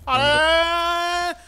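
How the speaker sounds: loud, high drawn-out scream; long yell. Real, not acted. Grito de susto no jogo. (grito longo e agudo)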